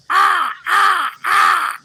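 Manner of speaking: high, loud cawing "ah, ah, ah"; kids' loud mock crow caws. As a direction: caw like kids mocking a crow. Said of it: "they'd go".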